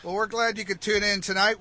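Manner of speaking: usual speaking voice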